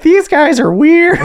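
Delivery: very high, slow mock child; high child judging them; kid voice. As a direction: squeak it like a puzzled kid. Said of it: "the kids are like".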